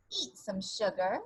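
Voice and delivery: plain reading voice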